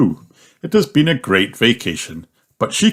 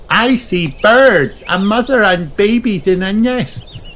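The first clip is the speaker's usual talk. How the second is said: high, bright little pig voice; high child voice; Peppa's voice; 11 semitones higher than usual. Acted. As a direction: play the excited piglet. Peppa's lines in a children's story.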